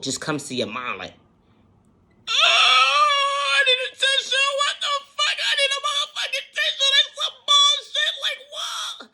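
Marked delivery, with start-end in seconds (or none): 2.3-9.1 very high, loud, hammed-up sobbing wail; mock crying. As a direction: overplay the tears for a laugh. Acting out a thought hitting suddenly.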